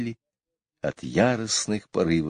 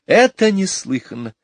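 high, loud outraged exclamation; a lady scandalised; high indignant outburst; 12 semitones higher, 5 dB louder than usual. A narrator's reading. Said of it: "возмущалась миссис Банкс".

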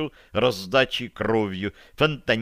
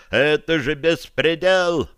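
higher, stretched-out protest; raised voice of protest; indignant outcry; 5 semitones higher, 4 dB louder, slower than usual. A narrator's reading. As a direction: call out slowly in indignation. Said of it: "прозвучал возмущённый окрик из зала".

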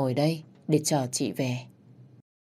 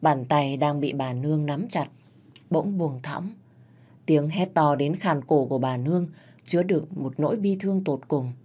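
ordinary delivery, nothing marked

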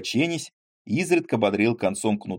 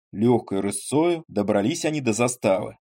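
plain reading voice

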